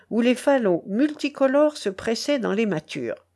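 ordinary delivery

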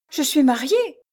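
high, loud plea rising at the end; desperate protest. A narrator's reading. Jeanne tells the stranger she is married, desperate. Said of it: "avec l'énergie du désespoir".

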